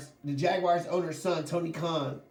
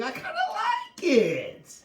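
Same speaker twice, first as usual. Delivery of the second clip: high, slow laughing squeal; 4 dB louder, slower than usual. Real, not acted.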